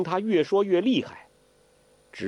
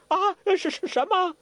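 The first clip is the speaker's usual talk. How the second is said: high startled yelp of alarm; startled old imp. Acted. Zhou Botong panics at hearing of the lady, startled. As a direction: jump out of your skin, much higher. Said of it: "周伯通大惊".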